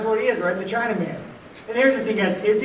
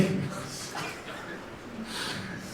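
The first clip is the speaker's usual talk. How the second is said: breathy laugh; 11 dB quieter than usual. Real, not acted. Laughs mid sarcastic rant.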